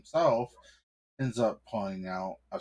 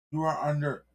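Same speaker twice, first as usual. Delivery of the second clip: cop voice pitched higher and a bit louder; an officious cop, pitch raised; nervous cop; 7 semitones higher, 4 dB louder, faster than usual. Acted. Cop explaining to the husband, pitched high and officious.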